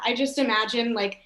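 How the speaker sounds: usual speaking voice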